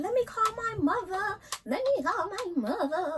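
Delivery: high, frantic pleading; frantic plea. Retold or imitated. The other girl in the office pleading to call her mother, frantic and high. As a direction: beg like you're losing it. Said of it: "Like, going crazy".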